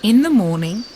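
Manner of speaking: usual speaking voice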